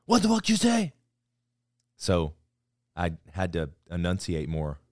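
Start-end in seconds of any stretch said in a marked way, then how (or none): 0.1-0.9 loud high mocking shout; yelling chief. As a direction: bark it like an angry drill chief. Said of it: "a reason to be like".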